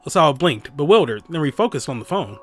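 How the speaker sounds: usual speaking voice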